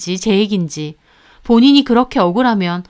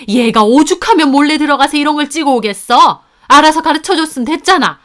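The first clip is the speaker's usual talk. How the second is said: berating; 3 semitones higher, 5 dB louder than usual. Acted. Mother-in-law berating daughter-in-law.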